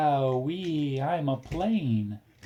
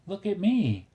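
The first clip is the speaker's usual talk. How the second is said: playful high read; 9 semitones higher than usual. Acted. Reading playful toy book line.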